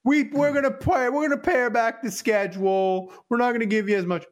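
hammed-up podcaster announcement; mocking other podcasts. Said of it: "Other podcasts are like".

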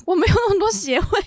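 high, loud wailing complaint, mock-tearful; whiny self-pity. Telling a friend about crying at a funeral, high tearful mock wail. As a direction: wail it like a lonely kid who has no one. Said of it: "他说".

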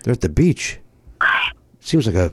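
mock whisper aside. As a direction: whisper it like a secret. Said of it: "whispered aside about the caller".

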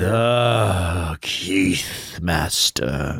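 very slow grand declaiming; classical stage acting. Shown as a demonstration. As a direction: draw it out on stage. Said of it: "a Shakespearean thing of awesomeness".

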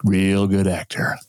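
slow, drawn-out dad voice; a son imitating his dad's slow verdict on an actor; dad impression. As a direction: do your dad, slow and deliberate, pleased with the actor. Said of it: "and he would go".

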